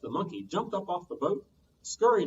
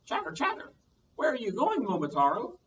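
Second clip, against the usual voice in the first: very high squeaky chatter; monkey meeting Momotaro, very high chatter; 8 semitones higher than usual. Acted. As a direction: chitter like a monkey. Monkey speaking.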